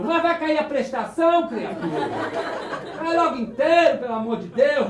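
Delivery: loud, fed-up retort with a laugh; João irritado manda o fantasma cair logo; annoyed. Acted. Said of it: "Aí João se aborreceu". (irritado e alto)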